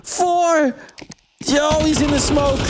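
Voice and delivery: excited countdown. Real, not acted. Live call of the final seconds, an excited countdown. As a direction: count it down to the wire.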